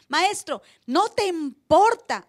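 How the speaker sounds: grito lento y alargado en importa; gritando. Retold or imitated. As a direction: arrastra el reclamo a todo pulmón. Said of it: "además se dice gritando". (los discípulos gritan al Maestro en la tormenta, lento y fuerte)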